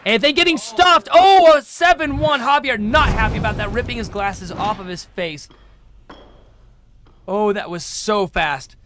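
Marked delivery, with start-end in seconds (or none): 0.0-5.5 much higher, louder shout at the goal; erupting on a scoring play; excited play-by-play. Real, not acted. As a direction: call the goal like it just blew the game open.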